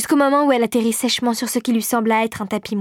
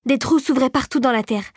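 plain reading voice

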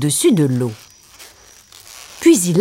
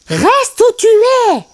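very high, drawn-out warning; Timon; 4 dB louder, slower than usual. A narrator's reading. Timon warns Pumba off the branch, high slow voice. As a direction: stretch it out in a high sidekick voice. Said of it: "lui conseilla Timon".

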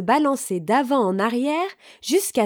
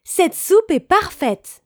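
higher, louder, drawn-out delight; delighted exclamation; 8 semitones higher, 5 dB louder, slower than usual. A narrator's reading. Goldilocks tasting the third bowl of soup, delighted. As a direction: savour it loudly, pitched up.

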